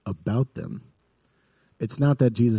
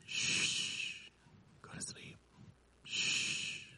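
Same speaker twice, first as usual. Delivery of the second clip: long shushes and a barely audible whisper; whispered hushing; 15 dB quieter, much slower than usual. Retold or imitated. Sleepy mom sends her kid back to bed, whispering. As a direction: hush a child back to sleep.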